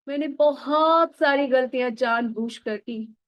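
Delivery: usual speaking voice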